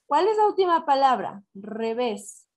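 usual speaking voice

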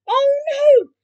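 very high, loud oh no; dismayed cry. A narrator's reading. Cats watching the hat fall, dismayed cry. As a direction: wail it out in alarm. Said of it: "cried the cats".

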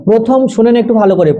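ordinary delivery

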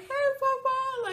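imitated teary high voice; slow, high, drawn-out greeting through tears; tearful greeting. Daughter finally hearing her dad, imitated tearful voice. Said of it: "the tears were just flo-, flowing".

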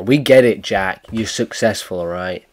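ordinary talk

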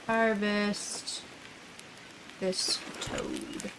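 ordinary delivery

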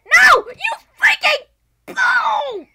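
high, drawn-out yell of defeat; real frustrated scream. Real, not acted. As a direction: scream it out slowly at full volume. Loses in the game.

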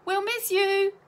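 high, loud schoolgirl shriek. A narrator's reading. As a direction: cry it out to a departing friend. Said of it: "shrieked Melanie".

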